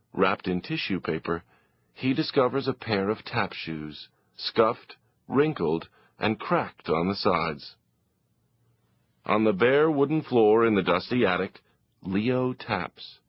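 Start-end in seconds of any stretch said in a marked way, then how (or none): none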